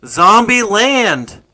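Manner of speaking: very slow, loud, over-enunciated; talking to hard of hearing. Real, not acted. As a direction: drag each syllable out clearly. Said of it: "Zombie what?".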